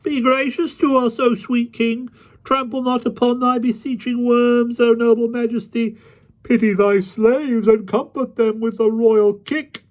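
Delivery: ironic high-pitched wailing plea; mocking supplication. A narrator's reading. Kneeling rabble begs the mock king for favour, mocking wail. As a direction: wail it high in sham worship. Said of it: "ironical wailings and mocking supplications".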